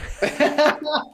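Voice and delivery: delighted high laugh; high burst of laughter. Real, not acted. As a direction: crack up at the impression. Cohost laughs at demo.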